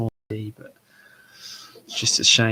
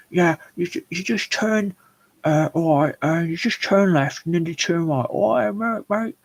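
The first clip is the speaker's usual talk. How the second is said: much higher put-on voice giving directions; imitated local man; 8 semitones higher, 5 dB louder than usual. Bloke giving street directions, high imitated accented voice. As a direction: caricature a passer-by's accented directions, pitched way up.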